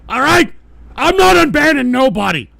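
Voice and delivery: high, loud, emphatic shouting; angry refusal. Real, not acted. Streamer refusing viewer's unban offer, loud angry refusal. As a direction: shout down the request, adamant.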